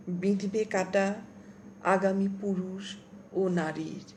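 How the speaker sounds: slow, measured poetry reading; poem recitation. Acted. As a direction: let each line hang with long pauses. Recites own poem aloud.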